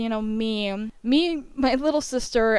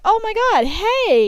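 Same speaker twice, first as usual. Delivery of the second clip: excited greeting; 11 semitones higher, 5 dB louder than usual. Retold or imitated. Greeting the remembered girl excitedly.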